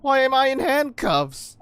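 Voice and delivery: building from puzzled halts to high shouting; acted alarm, rising into a loud yelp; panicked realisation. Dubbing character who finds himself handcuffed.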